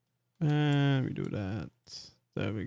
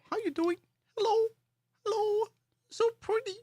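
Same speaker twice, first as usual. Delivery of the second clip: very high, squeaky little voice; cooing greeting; 3 dB quieter, faster than usual. Pretend. Streamer greeting Charlo in VR, high cooing voice. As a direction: coo sweetly in a tiny high-pitched voice.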